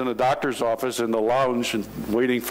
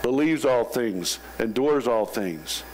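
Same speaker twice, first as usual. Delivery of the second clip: slow, solemn reading of scripture; slow, reverent scripture reading; slow recitation; 3 semitones lower, slower than usual. Real, not acted. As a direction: let each phrase land slowly.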